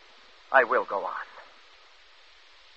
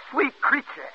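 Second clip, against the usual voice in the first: much higher swooning lover's cry; imitating a lover crying out in sleep; mock passionate cry; 12 semitones higher, slower than usual. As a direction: mimic a dreamer's ardent call. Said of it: "cry out".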